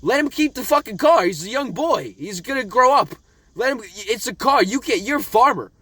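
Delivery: playful fury at a TV dad; mock-angry rant. Real, not acted. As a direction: berate him like he's right there. Ranting at the dad John.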